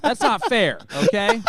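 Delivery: high, loud indignant whine at the teasing; loud protest. Real, not acted. Co-host protests loudly after being teased about her cologne. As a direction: cry out that it isn't fair. Protesting while cohost laughs.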